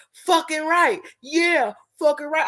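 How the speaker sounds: high, mock-eager sarcastic cheer; sarcastic agreement. Coworker mocking the woman's advice, sarcastic high cheer. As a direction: egg her on with fake enthusiasm. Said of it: "he was being sarcastic".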